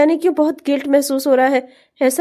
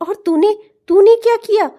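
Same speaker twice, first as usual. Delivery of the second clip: raised, higher voice demanding an answer; louder and higher, shocked anger; angry outburst; 6 semitones higher, 5 dB louder than usual. Acted. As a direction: scold him for what he did. Friend scolds over secret marriage.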